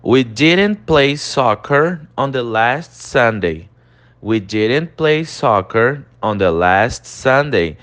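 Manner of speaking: slow, careful English model; teacher reading the example aloud; slow model sentence. Shown as a demonstration. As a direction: read it slowly twice. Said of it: "olha, nós temos".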